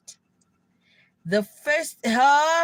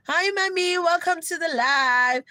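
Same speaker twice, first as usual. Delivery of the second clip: higher, chirpy hello to a viewer; bright greeting; 7 semitones higher, 3 dB louder than usual. Real, not acted. Greeting a viewer who just joined the live, cheerfully. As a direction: pop up brightly to welcome someone.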